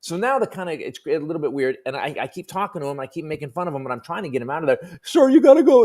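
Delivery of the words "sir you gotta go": put-on high, meek kid's voice pleading politely; squeaky nervous teenager asking him to leave; polite teen bouncer begging a drunk to go; timid teen bouncer.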